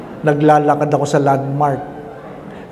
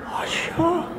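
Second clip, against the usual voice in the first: surprised recognition; 11 semitones higher, 11 dB quieter than usual. Retold or imitated. Recognising the old lady.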